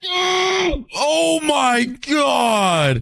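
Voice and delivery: high shriek into a slow drawn-out cry; startled scream. Real, not acted. Player screaming as a mob launches him. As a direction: yelp then stretch the words in shock. Blasted by knockback.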